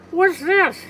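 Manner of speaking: high squawking duck question; Donald Duck voice. Acted. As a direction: squawk it in surprise. Donald Duck discovers leprechaun.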